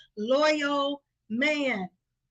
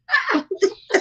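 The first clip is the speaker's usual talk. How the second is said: burst of laughter; 4 semitones higher, 6 dB louder than usual. Real, not acted. Laughing after joke.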